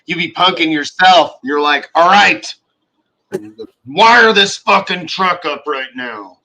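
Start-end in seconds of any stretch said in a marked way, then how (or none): 1.9-6.4 louder, higher whiny worker gripe; mock tired worker. Mocking a worker fed up with wiring trucks, whiny. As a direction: whine like a fed-up tradesman.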